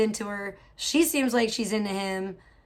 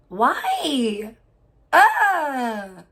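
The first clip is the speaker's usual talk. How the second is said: stunned outcry; 2 semitones higher, 6 dB louder than usual. Real, not acted. Disbelief at gross news.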